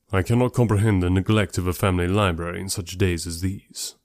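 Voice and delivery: reserved, serious pronouncement; Darcy. Acted. Darcy on neglect of a family library, grave.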